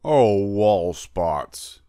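slow amused drawl; laughing cat. A narrator's reading. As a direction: savour it, amused. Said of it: "he laughed".

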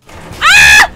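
piercing scream of fright; real panicked shriek; terrified scream. Real, not acted. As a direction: scream as the monster grabs you. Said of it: "random frights from your screams".

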